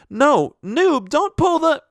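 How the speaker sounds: high alarmed cry to stop him; urgent teacher shout. Retold or imitated. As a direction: shout a warning, panicking. Teacher urgently stops child.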